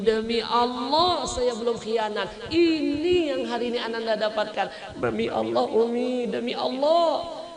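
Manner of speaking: high, loud, tearful swearing to mother; emotional plea. Acted. Son pleads with his mother, tearful and high. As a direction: beg her to believe you, voice near breaking.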